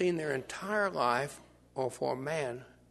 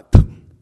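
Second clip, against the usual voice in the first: imitated spit; 24 dB louder than usual. Imitated spitting sound.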